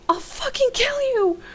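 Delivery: high, slow screamed threat; enraged scream. A narrator's reading. Hero punching Rick and screaming, enraged. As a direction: scream it out, stretched and furious. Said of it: "so you keep punching and screaming".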